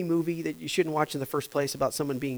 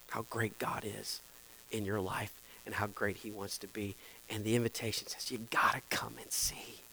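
much softer, whispering; hushed invitation; 7 semitones lower, 8 dB quieter than usual. Acted. Pastor inviting congregation to come and see, whispered. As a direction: draw them close with a whisper.

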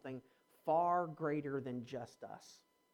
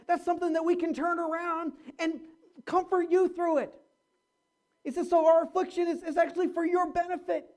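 loud, higher sermon delivery; full-force preaching; 10 semitones higher, 6 dB louder than usual. Acted. Pastor expounding Paul to the church, in loud preaching voice. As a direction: proclaim it with fire. Preacher reading and exhorting loudly.

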